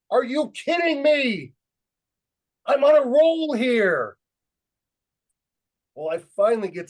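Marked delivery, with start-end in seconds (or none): 0.1-4.2 high, slow, drawn-out shout; carried away, bellowing each phrase out long; exuberant yell. Retold or imitated. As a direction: holler it back in mock disbelief. Yelling back at the complaining teacher.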